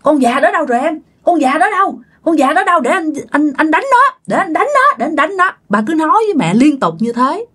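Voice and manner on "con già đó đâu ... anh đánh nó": put-on higher, agitated voice hunting the old woman; half-awake husband spoiling for a fight; retold agitated outburst; agitated demand. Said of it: "Thì ba mới nói với mẹ vậy".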